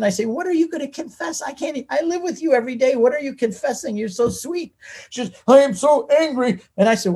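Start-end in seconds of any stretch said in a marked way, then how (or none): 0.3-4.7 higher-pitched, incredulous questioning; puzzled husband, voice lifted high; playful disbelief. Retold or imitated. Husband asks his gentle wife about confession, playfully incredulous. 5.5-6.6 high-pitched imitation of an angry wife; mock angry. Retelling tiny wife's fury after Mass, mock angry. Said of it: "she clenched her little fist".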